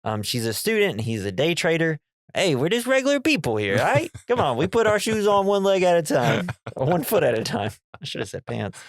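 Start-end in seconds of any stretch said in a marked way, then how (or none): 2.3-7.7 bro voice. Retold or imitated. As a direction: play it as a chummy regular guy. Voicing Mika to the psychic.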